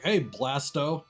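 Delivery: normal talking voice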